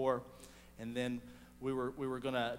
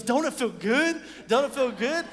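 much higher and louder, bursting with joy; excited outburst; 10 semitones higher, 9 dB louder than usual. Retold or imitated. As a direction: jump up and gush. Girl jumps from her seat.

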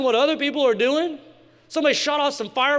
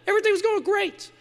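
high sing-song; 10 semitones higher, faster than usual. Acted. Sing-song setup before the fall.

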